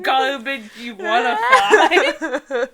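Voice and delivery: slow, loud words through real laughter; laughing. Real, not acted. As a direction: say it while cracking up. Laughing while describing the book.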